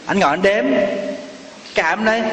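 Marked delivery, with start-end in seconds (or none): none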